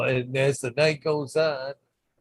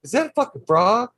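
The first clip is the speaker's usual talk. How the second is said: high mock commenter; 8 semitones higher, 5 dB louder than usual. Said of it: "somebody in comments is like".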